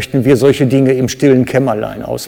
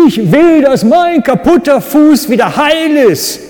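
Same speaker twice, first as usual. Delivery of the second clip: much higher and louder, firmly stating the wish; forceful demand; 12 semitones higher, 4 dB louder than usual. Shown as a demonstration. Preacher demonstrates telling Jesus plainly what you want, a forceful shout. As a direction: shout the request with full conviction. Preacher shows a concrete request.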